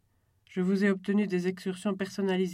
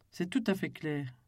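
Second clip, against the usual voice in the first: ordinary delivery, nothing marked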